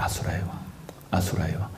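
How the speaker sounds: hushed dramatic whisper. Real, not acted. Monk whispers the asura king's name to listeners. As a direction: breathe the name like a secret.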